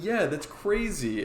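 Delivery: high, drawn-out amazement; retold excitement. Bartender retelling his chat with his brother, excited. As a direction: imitate an amazed fan recounting it.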